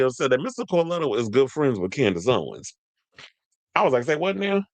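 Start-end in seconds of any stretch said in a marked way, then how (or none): 4.0-4.6 high-pitched, incredulous reaction; retold surprise. Host retelling reaction to spilled tea, surprised. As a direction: play back a taken-aback response.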